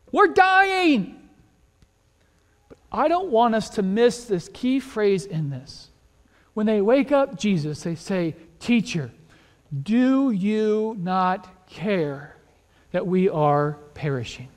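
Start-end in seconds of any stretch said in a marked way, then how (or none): 0.1-1.3 high, drawn-out cry of alarm; panicked cry. Acted. Disciples wake Jesus in the storm, panicked cry. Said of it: "they wake up Jesus and they say". 8.6-14.5 very slow, word by word; slow, weighted quote. Acted. Preacher dwells on disciples' key phrase, slow and weighted.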